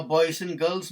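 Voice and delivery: ordinary delivery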